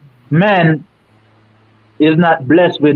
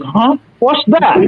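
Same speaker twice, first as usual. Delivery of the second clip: higher-pitched mock puzzlement; clueless listener; 5 semitones higher than usual. Woman lost when he talks history, high mock-baffled question. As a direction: imitate someone who has no idea what you mean. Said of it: "look at me like".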